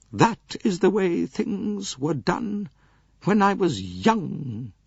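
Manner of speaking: put-on high, slow haughty old lady; lofty aged princess voice. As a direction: voice the elderly princess, cool and proud. Princess voice held.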